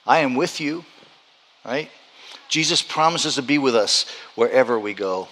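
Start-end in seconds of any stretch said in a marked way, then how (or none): none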